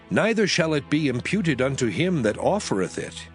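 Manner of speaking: usual speaking voice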